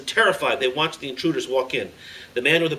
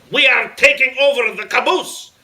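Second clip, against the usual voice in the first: put-on loud, high-pitched yell in a foreign accent; full-force accented villain shout; 12 semitones higher, 9 dB louder than usual. A narrator's reading. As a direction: yell it out as the terrorist leader. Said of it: "held up his weapon and yelled".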